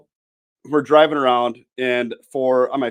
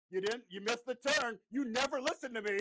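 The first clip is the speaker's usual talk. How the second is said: scolding nagging wife, higher; a son mimicking a scolding wife; 11 semitones higher, 12 dB quieter than usual. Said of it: "then he goes just perfectly".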